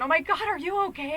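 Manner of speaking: playful imitation of a worried friend. Friend calls him on the phone, worried and high. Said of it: "his friend's on the other end of the phone going".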